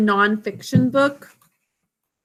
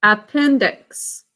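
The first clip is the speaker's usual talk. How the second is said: slow sounding out; 3 semitones lower, much slower than usual. Shown as a demonstration. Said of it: "D, x".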